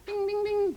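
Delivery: very high, ringing "ding" sounds; imitated bell. Host fills in when nobody is on the line, imitated bell. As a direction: mimic a bell in a high pitch.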